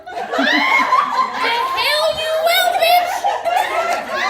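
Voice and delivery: very high, drawn-out scream of refusal; screeched comic refusal; defiant yell. Retold or imitated. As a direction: shout it slowly at full volume. Acted refusal to return tomorrow.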